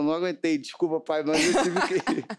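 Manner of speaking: giggling apology; retelling mother laughing at the wake; laughing through words. Retold or imitated. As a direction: try to say it while the laugh breaks out. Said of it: "minha mãe começou a rir".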